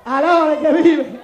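loud preacher's praise. Acted. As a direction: call it out to the church.

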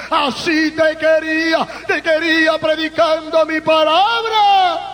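God speaking to his servant from the sky. Retold or imitated. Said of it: "me dice".

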